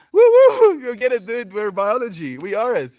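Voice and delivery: high, loud cheer for a friend; root for them at full voice; real hype that stays loud to the end. Real, not acted. Cheering a viewer's biology final.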